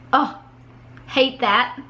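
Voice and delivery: ordinary delivery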